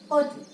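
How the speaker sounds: होटल repeated short as 'ho-tl'; a brief होटल with a swallowed last vowel; correct pronunciation. Shown as a demonstration. Teacher repeats the model for learners, careful pronunciation. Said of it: "We say होटल".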